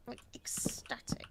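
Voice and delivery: dry deadpan whisper; sarcastic whisper. Acted. Sarcastic claim of being happy.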